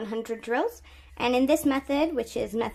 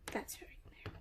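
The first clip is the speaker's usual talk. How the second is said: whispered; 21 dB quieter, faster than usual. Real, not acted. Muttering to self while working.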